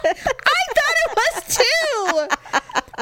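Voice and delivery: high, drawn-out squeal through laughter; laughing admission. Real, not acted. Co-host agreeing she got the band name wrong, laughing. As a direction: squeal it slowly while laughing. Laughing at her own mistake.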